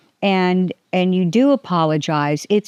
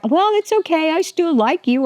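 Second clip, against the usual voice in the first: gushing forgiver; 9 semitones higher than usual. Retold or imitated. Someone forgiving her again.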